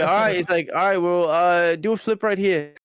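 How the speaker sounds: imitating an Aussie casually pointing at a spot; Australian accent. Australian filmmaker telling them to flip right there, mock Australian accent. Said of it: "like, Australian".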